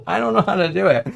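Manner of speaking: loud, fast flustered laughing. Real, not acted. Embarrassed streamer laughing, failing the character voice.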